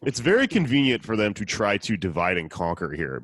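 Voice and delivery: ordinary delivery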